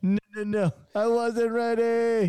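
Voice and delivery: high, slow, strained squeal; Kevin Hart impression. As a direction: squeak it out like a panicked comic. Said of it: "That's what your stomach was saying".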